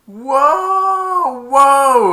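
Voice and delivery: high loud startled whoas. Retold or imitated. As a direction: ride out a wild carpet swerve. Flying out of the lava.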